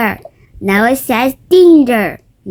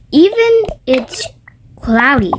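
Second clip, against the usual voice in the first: ordinary delivery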